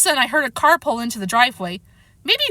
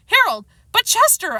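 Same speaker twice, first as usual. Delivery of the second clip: stammering; 11 semitones higher, 4 dB louder than usual. A narrator's reading. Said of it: "I stammered".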